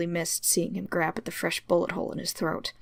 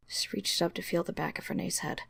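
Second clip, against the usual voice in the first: ordinary delivery, nothing marked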